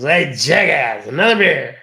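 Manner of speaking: put-on a drawn-out, loud drunk barking for a beer; drunk bar patron. Drunk guy at the bar shouts for another beer, slow and loud. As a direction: holler it like a drunk regular.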